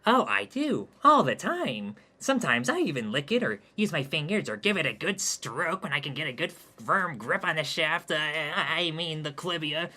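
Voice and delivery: bragging. Acted. Brags in loud character voice.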